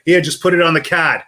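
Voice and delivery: "card" with the r dropped, like "cahd"; New England "card". Retold or imitated. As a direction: say "card" as "cahd", Boston style. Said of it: "I'm gonna s-, pronounce it properly".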